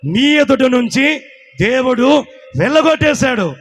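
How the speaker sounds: preaching proclamation. Acted. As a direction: proclaim it slowly to the congregation. (నెమ్మదిగా, బిగ్గరగా ప్రకటించే బోధ)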